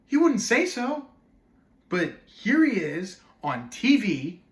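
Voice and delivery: loud, high, exasperated argument; worked-up outrage with big pitch swings; indignant rant. Acted. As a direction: rail at the interviewer, punching key words. Acted indignant rant at Missus Oates.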